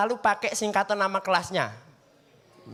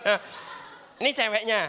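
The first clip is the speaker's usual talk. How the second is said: ordinary delivery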